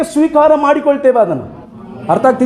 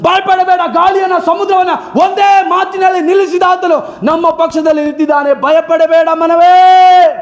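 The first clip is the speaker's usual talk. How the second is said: raised, high-pitched preacher's cry; fiery preaching; 4 semitones higher than usual. Acted. Preacher rallying the congregation against fear, fiery preaching. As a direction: preach it out full force to the hall.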